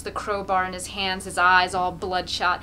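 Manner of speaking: ordinary delivery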